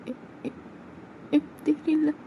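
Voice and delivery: calling Homi, afraid; frightened girl voice; scared plea. Acted.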